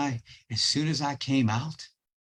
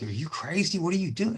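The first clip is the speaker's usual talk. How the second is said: incredulous police; 7 semitones higher than usual. Retold or imitated.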